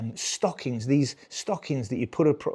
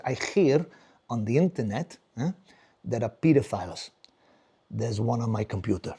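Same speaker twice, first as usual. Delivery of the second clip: put-on low, halting Spanish-accented English; 4 semitones lower than usual. Spanish father warning his son about the internet, accented. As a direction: confide it gravely in a thick Spanish accent. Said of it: "He goes".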